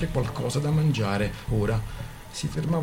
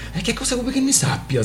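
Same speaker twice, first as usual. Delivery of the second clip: much higher angry outburst; blurting out; 13 semitones higher than usual. Acted. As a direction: burst out at him, exasperated. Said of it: "sbottò la ragazza".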